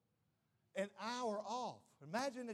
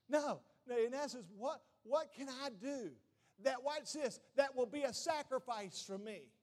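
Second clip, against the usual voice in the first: preaching at full force; 5 semitones higher, 4 dB louder than usual. Acted. Preaching climax.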